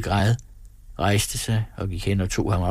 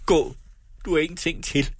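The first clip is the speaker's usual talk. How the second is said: frenzied agitation; 7 semitones higher than usual. A narrator's reading. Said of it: "i vanvittig ophidselse".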